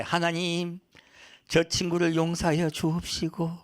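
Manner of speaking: slow, level mock-prayer chant; insincere pious prayer. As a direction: intone a dutiful prayer you don't mean. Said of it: "내 속에 없는 말 하면".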